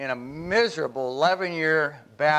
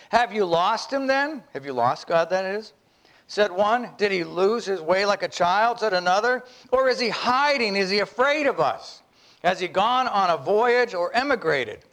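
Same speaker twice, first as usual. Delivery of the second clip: lightly voiced mocking crowd, raised; mocking villagers; 6 semitones higher than usual. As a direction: tease the madman like a jeering townsman. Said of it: "Thus they shouted and laughed".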